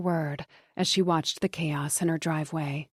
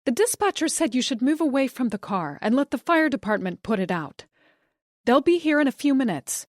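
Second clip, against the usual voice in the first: shouting; 6 semitones higher, 4 dB louder than usual. A narrator's reading. Wife shouting relayed instructions.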